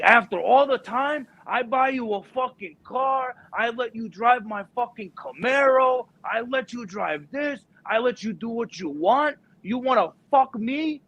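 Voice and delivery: high-pitched mock rant; hammed-up betrayed father; dad going crazy. Dad ranting at son in school, hammed-up anger. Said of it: "starts going fucking crazy".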